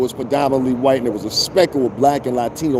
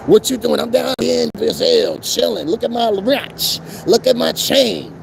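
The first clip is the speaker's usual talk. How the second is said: mocking tourist guy; 10 semitones higher than usual. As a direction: brag about the watch and chain. Mock phone brag from Brazil.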